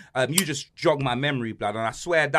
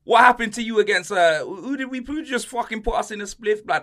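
much higher and louder mocking voice; imitating a taunting girl; 7 semitones higher, 7 dB louder than usual. Imitating the schoolgirl who mocked him at Westfield, high and taunting. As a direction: mimic a kid teasing a grown man. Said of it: "yeah, she was like".

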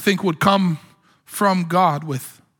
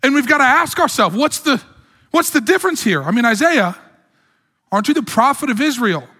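rising fervent preaching; 6 semitones higher than usual. Acted. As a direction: press the question with mounting urgency. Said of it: "rising preaching intensity".

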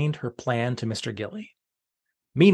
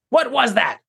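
higher startled shout; shocked shout; 9 semitones higher than usual. Acted. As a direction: jolt in surprise. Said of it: "he shouted in shock".

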